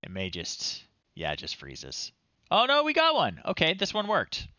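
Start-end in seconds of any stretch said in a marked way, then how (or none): 2.5-4.5 surprised delight. Real, not acted. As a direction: jump up in pitch as it finally works. Program finally loads after freezing.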